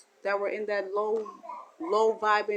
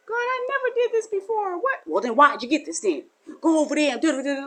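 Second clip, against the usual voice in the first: much higher, loud pleading voice; pleading sinner and retort; 8 semitones higher, 5 dB louder than usual. Acted. A sinner arguing with God, much higher and loud. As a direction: protest innocence, then get sent off.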